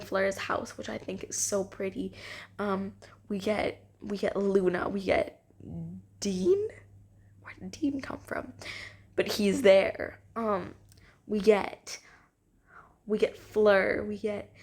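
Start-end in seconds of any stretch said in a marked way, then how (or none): 6.2-8.5 puzzled whisper. Acted. Puzzled aside about Dean.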